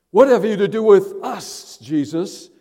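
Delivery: high, loud, hostile cry; demon shouting. Acted. Possessed man shouting at Jesus, high and loud. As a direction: hurl the questions at him.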